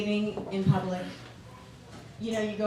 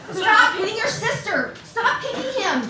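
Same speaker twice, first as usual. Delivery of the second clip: high, louder mock scolding, slow start; scolding parent; 10 semitones higher, 5 dB louder than usual. Mom yells at squabbling kids in the mall, hammed-up scolding. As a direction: scold the kids sharply but for laughs.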